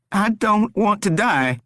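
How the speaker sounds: high, desperate begging; pleading captive Rory. A narrator's reading. Rory begs the stranger to spare him, desperate.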